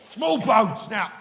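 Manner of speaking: loud, drawn-out comic outburst; worked-up shout. Pretend. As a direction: holler it slowly, hammed up. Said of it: "I've set myself off".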